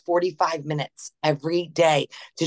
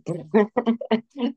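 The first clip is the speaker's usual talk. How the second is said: nervous laugh; 5 semitones higher than usual. Real, not acted. Caught by a hard question.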